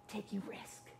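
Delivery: breathy hush on the answer; a dramatic whispered punchline. Acted. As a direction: let the answer drop to a whisper. Sermon refrain, answer to own question.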